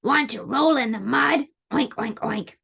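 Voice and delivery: oinking piggy talk. Acted. Pig invites spider into the mud, grunting voice. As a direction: ask like a pig, ending in oinks. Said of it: "grunted the pig".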